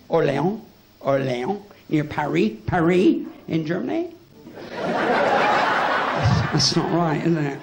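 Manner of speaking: lightly put-on French accent on place names. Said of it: "I'll do the accent for you".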